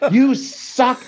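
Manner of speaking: high, shrill-pitched mock rant at teachers; hammed-up parental outrage, voice pushed way up; angry parents yelling. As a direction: rant like a furious parent who wants teachers back at work. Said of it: "and then they're like".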